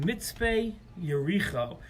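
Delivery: Mitzpe Yericho said slowly, word by word; slow and clear. Shown as a demonstration. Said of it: "Let me say it again slowly".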